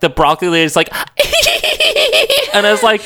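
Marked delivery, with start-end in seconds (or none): 0.9-2.5 very high mock cackle; imitated squeaky laugh. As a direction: mimic her with a high-pitched titter. Broccoli lady's sound imitated mid-retelling.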